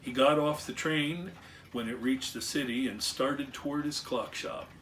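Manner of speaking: usual speaking voice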